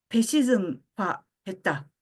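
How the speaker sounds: normal talking voice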